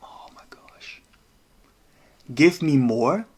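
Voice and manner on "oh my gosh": breathless whispered oh my gosh; under the breath, stunned; whispered disbelief. Real, not acted. Streamer reacting to the fight, stunned whisper.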